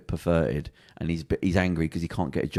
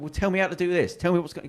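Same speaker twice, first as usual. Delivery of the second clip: insistent demand pitched high; challenging doubter; 11 semitones higher than usual. Retold or imitated. Second doubter in the pub testing him, challenging. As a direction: imitate a sceptic firing questions in a high voice.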